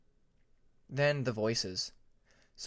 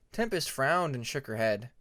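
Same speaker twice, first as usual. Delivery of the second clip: ordinary delivery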